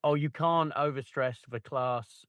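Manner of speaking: usual speaking voice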